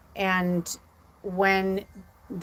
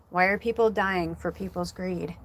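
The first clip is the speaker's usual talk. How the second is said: ordinary talk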